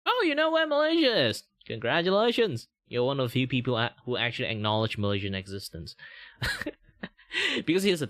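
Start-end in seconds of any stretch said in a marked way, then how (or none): 0.0-2.7 excited. Pretend. Mock-excited greeting to a viewer.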